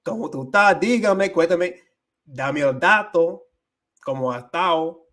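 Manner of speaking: loud, high Dominican Spanish; lively Caribbean Spanish hello; Dominican greeting. Retold or imitated. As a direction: greet a friend with Dominican flair. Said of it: "with my Dominican friends".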